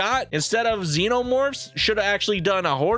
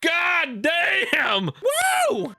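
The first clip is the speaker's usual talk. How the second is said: excited whoop; 10 semitones higher, much slower than usual. Real, not acted. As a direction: whoop it up in amazement. Whooping at the bursting fruit.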